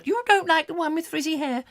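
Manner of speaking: put-on very high shocked old lady; gossiping old lady. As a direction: squeal it, high and prim. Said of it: "I've got to get out of character".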